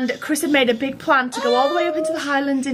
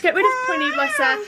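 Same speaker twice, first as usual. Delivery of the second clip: mock sad; 12 semitones higher than usual. Mock sorrow over leaving the dog.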